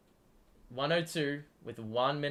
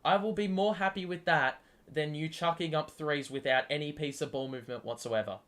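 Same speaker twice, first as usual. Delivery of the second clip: ordinary delivery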